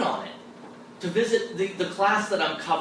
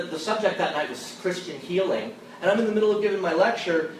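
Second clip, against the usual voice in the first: usual speaking voice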